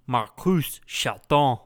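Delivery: slow mock French accent. Introducing himself as the podcast's French host, slow French accent. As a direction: play a hammy French host, drawn out. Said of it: "your French host".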